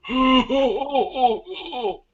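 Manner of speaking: put-on high, croaky old man groaning, choking; wheezing stoner grandpa with a choke. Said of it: "a very old man who's smoking too much weed".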